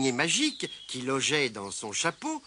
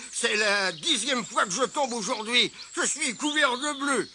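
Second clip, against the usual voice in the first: higher-pitched cross complaint; grumbling; 7 semitones higher than usual. A narrator's reading. Mole complains of his tenth fall today, grumbling. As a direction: grouse about falling over yet again. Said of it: "marmonna-t-il".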